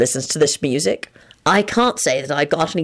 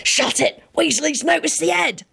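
plain reading voice

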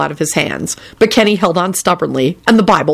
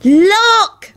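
high-pitched joyful yelp; happy yelp; 3 dB louder than usual. A narrator's reading. Natalie pointing out the bodies, happy yelp. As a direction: yelp with delight. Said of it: "Natalie yelped happily".

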